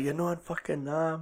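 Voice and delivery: a mock Walter yelling in the heat of the moment; Walter's outburst. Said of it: "And it's like".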